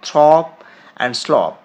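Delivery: থ্রপ and স্লপ said loud and clear; pronunciation pair; model words. Shown as a demonstration. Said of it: "এই দুটো প্রায় একই রকম".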